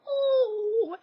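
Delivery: high, loud, drawn-out "oh"; adoring coo. Retold or imitated. Vlogger reacting to a cute friend, high loud coo. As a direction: coo as if melting at cuteness. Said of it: "everything he did made me go".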